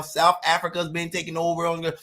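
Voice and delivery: usual speaking voice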